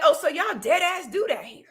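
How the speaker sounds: amazed question, lightly re-enacted; shook. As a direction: retell your amazed reaction, voice lifting. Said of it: "I was shook".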